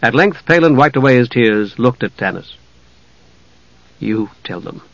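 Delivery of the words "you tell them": very quiet, subdued request; hushed voice; softly. A narrator's reading. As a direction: say it softly, wearily handing over. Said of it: "he said softly".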